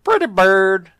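loud, high parrot squawk. Acted. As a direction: belt it out like a parrot. Parrot joke picked up.

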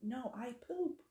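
a hint of a stubborn toddler; imitated insistent toddler. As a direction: lightly mimic a child correcting mom.

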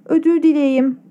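put-on "Özür dileyim" with a light childish lisp; slight lisp. A narrator's reading. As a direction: soften the r into a y: "dileyim". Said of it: "hafif peltek bir şekilde".

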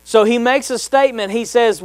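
ordinary delivery

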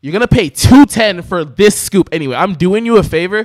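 loud, exasperated mock comeback; retold telling-off. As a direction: lay into the customer, retelling it loudly.

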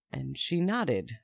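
plain reading voice

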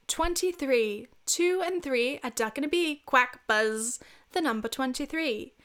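singsong English bingo caller; mock English accent. Bingo caller reading numbers, English accent. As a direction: put on your best English accent, lilting. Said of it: "try my best English accent".

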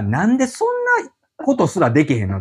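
a hint of impatient scolding; exasperated retort. Recalled irritation at clients. (来ない利用者への苛立ちを真似た、軽いあきれ声)

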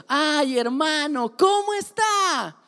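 put-on high, slow, syrupy voice for little kids; mock children's-class teacher greeting; sugary kids' teacher. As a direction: talk down sweetly as if to a child. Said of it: "va a estar diciendo".

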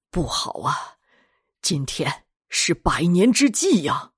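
put-on slow, dismayed old patriarch; grave old master. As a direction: realise the doom, slowly and heavily. Old master realises the dreaded date.

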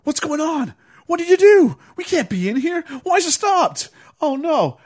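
high, loud hammed-up panic; high hammed-up panicked cries; overacting. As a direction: overact the bad guy's panic. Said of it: "it's like overacting one".

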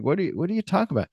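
rapid, high confused protest; fast and high. Retold or imitated. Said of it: "he's like".

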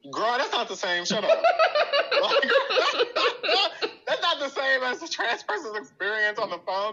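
high disbelieving laugh; laughing exasperation. Real, not acted. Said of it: "exasperated, laughing reply".

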